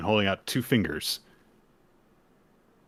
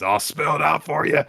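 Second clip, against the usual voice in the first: high, loud demonic shout; 9 semitones higher, 8 dB louder than usual. Acted. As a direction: roar it like a gleeful demon. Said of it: "demonic version of Denji's voice".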